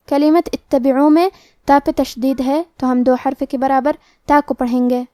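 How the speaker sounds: ordinary talk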